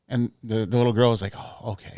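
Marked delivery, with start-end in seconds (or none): 1.4-1.9 small relieved whisper. Retold or imitated. Little girl relaxing, reassured.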